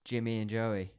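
loud, slow, hammed-up horror host; theatrical show intro. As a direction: boom out the segment title, drawing out each word. Theatrical segment intro over music.

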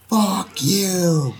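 high-pitched retold yell, volume held back; high, half-hearted shout; mock loud yell. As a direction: imitate yelling across a doorway. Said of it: "really loud".

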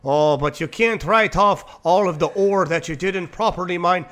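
put-on Skyrim-style Nordic accent, drifting Irish; lightly put-on Swedish accent. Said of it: "Like a fucking Skyrim character".

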